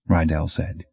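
ordinary delivery

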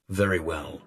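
low, measured consent; disembodied guardian voice. A narrator's reading. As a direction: concede quietly after holding back. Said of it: "Presser said at last".

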